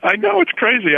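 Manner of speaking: high, squeaky laugh through the words; real amused laughter breaking into speech; laughing agreement. Real, not acted. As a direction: agree while cracking up, voice shooting up high. Amused agreement over shared dependence on phones.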